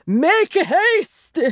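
put-on high, urgent call in a foreign accent; melodramatic foreign shout. A narrator's reading. Foreign nobleman hurrying whoever answers the door, urgent high shout. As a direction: call out imperiously, pitched high. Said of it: "a foreign accent in his deep voice".

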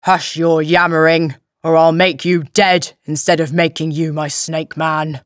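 loud, menacing thug voice; growling brute. Acted. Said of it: "The big brute who had him growled".

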